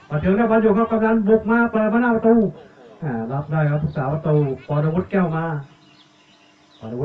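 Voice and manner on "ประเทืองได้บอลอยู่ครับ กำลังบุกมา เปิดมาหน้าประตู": raised, louder football commentary; urgent commentator's lift; excited play-by-play. Real, not acted. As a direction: call the attack as it builds, high and loud. Live call of an attack.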